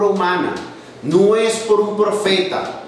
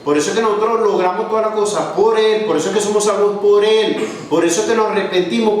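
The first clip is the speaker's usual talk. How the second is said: quick, driving preacher's build, "por él" hammered home; fast preaching; 3 semitones higher, much faster than usual. Acted. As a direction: preach it fast and push the refrain. (predicación rápida e insistente)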